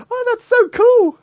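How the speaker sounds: put-on high, child's voice, curious; small kid. Little boy on the beach asking the sound man, high child voice. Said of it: "He went".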